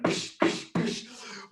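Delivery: voiceless "psh" bursts; short percussive hushing sounds; whacking noises. Pretend. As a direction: imitate smacking a broken thing. Imitates banging the dreidel.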